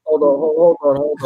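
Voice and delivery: loud interjection. Real, not acted. Cutting in over the others.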